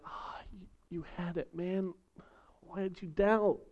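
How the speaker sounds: hushed, gentle Jesus; softly, like a kind friend. Pretend. Jesus gently reassuring a sinking Peter, hushed. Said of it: "Was it gentle?".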